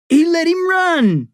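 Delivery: put-on furious boy screaming the accusation. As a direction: scream it with outrage. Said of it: "Eric screamed".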